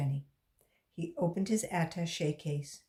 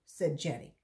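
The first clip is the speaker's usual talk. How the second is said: ordinary delivery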